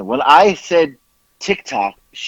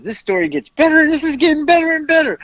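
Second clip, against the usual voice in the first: very high, gleeful mimicry of someone cracking up; imitating laughing caller; 13 semitones higher, faster than usual. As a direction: retell it high-pitched, as if overcome by giggles. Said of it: "She couldn't stop laughing".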